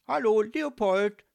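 loud, high-pitched hail; calling out. A narrator's reading. Micky hailing his distracted ghost friend, calling out loudly. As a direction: call out to get his attention.

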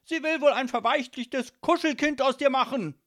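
high, loud scornful rant; incensed father yelling; agitated shouting. A narrator's reading. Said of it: "rief er aufgebracht".

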